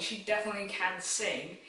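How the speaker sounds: ordinary delivery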